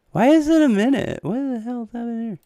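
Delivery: high, loud bewildered outburst; sudden squeaky disbelief; baffled streamer shrieking; shocked confusion. Real, not acted.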